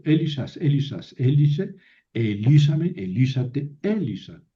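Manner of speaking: brisk drill from Elisas to Elisa, each accent punched; recitado con acentos marcados. Shown as a demonstration. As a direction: rattle off the Greek forms, marking where the accent moves. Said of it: "Entonces se mueve el acento". (recitado ágil de conjugación, acentos marcados)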